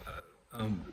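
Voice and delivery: ordinary talk, nothing marked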